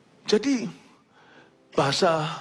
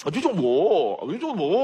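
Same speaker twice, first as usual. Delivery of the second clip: mock Korean accent. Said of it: "Saya niruin orang Korea".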